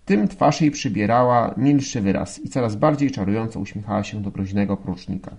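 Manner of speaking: plain reading voice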